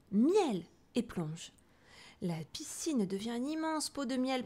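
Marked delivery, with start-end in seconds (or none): 0.1-0.6 loud cry of honey; shouted wish. A narrator's reading. Bear shouts his wish as he dives, loudly. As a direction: bellow the single word. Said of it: "il crie".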